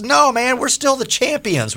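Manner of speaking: high, cocky defiance; retelling a fan's defiant reply with attitude. Retold or imitated. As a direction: throw it back at him, smug. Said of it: "with kind of an attitude".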